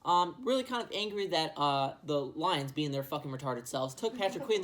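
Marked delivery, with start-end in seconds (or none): none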